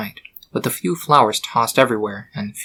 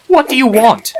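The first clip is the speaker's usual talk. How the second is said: high, loud exasperated cry; exasperated yell; 12 semitones higher, 8 dB louder than usual. Acted. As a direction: snap at the foal, fed up. Annoyed statue snaps at foal.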